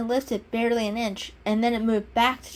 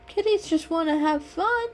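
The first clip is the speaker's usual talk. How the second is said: much higher, carefree kitten line; shrugging; 9 semitones higher than usual. A narrator's reading. Kitten shrugging off the owner's scolding, carefree. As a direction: toss it off with a shrug. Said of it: "Mister Fluffles shrugged".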